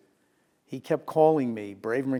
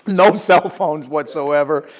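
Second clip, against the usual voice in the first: ordinary delivery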